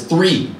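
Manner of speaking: THREE punched out high; stress on THREE. Acted. Emphatic repeat of the count of days.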